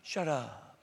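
soft, gentle hush of a kind God; kinder shut up. Retold or imitated. God gently telling a gossip to hush, softly. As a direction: let it fall softly, like God being gentle. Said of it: "Or, no, he'll be kinder".

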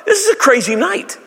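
high astonished shouting; amazed disciples. Pretend. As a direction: gasp it like stunned friends. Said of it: "the disciples are like".

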